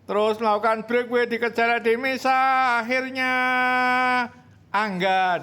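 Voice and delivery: very high, loud sprint call; excited race call. Real, not acted. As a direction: scream the final sprint.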